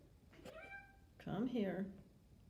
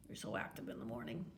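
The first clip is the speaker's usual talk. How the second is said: soft, low murmur; 10 semitones lower, 8 dB quieter than usual. Real, not acted. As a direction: murmur it softly.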